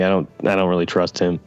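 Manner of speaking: usual speaking voice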